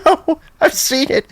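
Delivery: very high, mock knowing squeal; retold hostel worker. Hostel worker confirming his blunder, imitated in a very high voice. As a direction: imitate someone gleefully in the know, pitch leaping up. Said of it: "And she goes".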